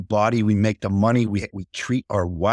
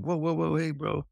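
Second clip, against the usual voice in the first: softer, higher mock interjection; imitated calming down; 5 semitones higher, 6 dB quieter than usual. Friend interrupting a dad scolding his son, calming. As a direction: gently step in, voice up and soft. Stepping in to calm a father.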